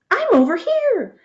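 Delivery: high yell through the rain; yelling back. A narrator's reading. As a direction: shout over the storm. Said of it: "he yelled".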